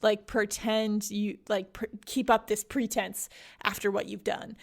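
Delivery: usual speaking voice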